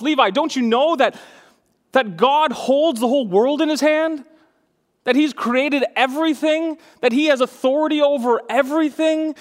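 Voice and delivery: put-on high, insistent pleading with a child; acted earnest explaining. Dad explaining God's authority to son Levi, impassioned acted plea. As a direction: build question on question, urging him to understand. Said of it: "I'm trying to explain to him".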